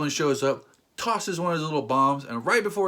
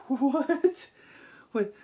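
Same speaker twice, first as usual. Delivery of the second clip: disbelieving what; 10 semitones higher than usual. Real, not acted. Disbelief after laughing.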